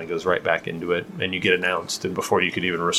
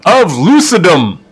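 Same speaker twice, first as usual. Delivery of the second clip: high, loud drawn-out herald call; herald announcement; 11 semitones higher, 13 dB louder than usual. Retold or imitated. Herald announces Relop to the noble party, booming. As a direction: proclaim each name to the hall.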